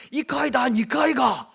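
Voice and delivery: loud, high Gyeongsang retort; Gyeongsang dialect. Acted. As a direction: fire back in booming Gyeongsang dialect. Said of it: "이렇게 했더니 경상도 사람". (경상도 사투리로 크게 쏘아붙이듯)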